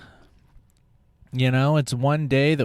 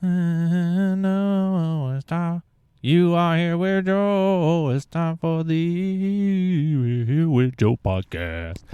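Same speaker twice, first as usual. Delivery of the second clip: higher, slowed announcer read; second take of a hammed-up podcast opener; podcast announcer intro; 5 semitones higher, slower than usual. Shown as a demonstration. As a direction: try the intro again, a bit smoother and slower. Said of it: "That would have been nice".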